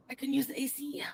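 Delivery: soft unvoiced whisper. Real, not acted. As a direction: whisper it under the breath. Whispered aside.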